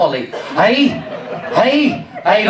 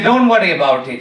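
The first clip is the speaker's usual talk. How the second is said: lower-pitched mock Indian accent; 6 semitones lower than usual. Doctor reassuring a patient with sore throat, Indian accent. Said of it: "He was an Indian doctor".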